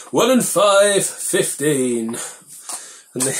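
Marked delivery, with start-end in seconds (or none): 0.1-2.2 high, loud bingo number call; bingo caller. Acted. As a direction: sing out the number like a bingo hall. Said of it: "I sound like a frickin' bingo caller".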